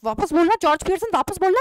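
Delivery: loud, quick, high order; scolding. Acted. Mrs Pearson loudly and quickly orders George to take it back. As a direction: snap the order at him, fast and loud.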